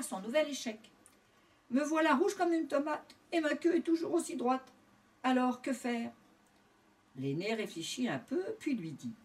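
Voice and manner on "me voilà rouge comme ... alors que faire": higher-pitched storybook dismay; disappointed little pig. A narrator's reading. Doudou telling his brother of his latest failure, disappointed. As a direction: let down, in a raised character voice. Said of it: "Doudou, déçu, conta à son frère".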